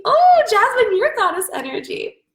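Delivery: thrilled high burst at a name; light up on seeing a friend in the chat; excited. Real, not acted. Coach spotting a viewer in the chat: delighted high burst.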